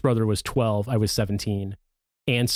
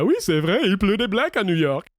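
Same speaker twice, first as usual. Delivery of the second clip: hammed-up French dubbing actor, higher; mocking French dub voice; 8 semitones higher than usual. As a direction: mimic a limp French voice actor on Will Smith's line. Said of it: "doing the French voice actor dirty here".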